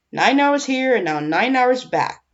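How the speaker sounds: grumbling. A narrator's reading. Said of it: "the guard grumbled".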